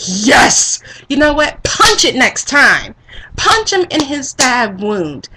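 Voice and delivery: loud, slow, punchy cheering; gleeful egging on. Retold or imitated. Viewer egging Johnny on to hurt Anthony, loud glee. Cheering on violence against hated character.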